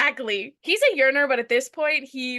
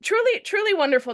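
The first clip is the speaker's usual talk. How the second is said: laughing; 10 semitones higher, 7 dB louder than usual. Real, not acted. Laughing mock celebration.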